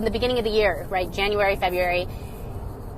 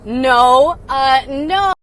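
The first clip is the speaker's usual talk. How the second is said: exasperated no; 5 dB louder, much slower than usual. Retold or imitated. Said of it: "I'm watching this going".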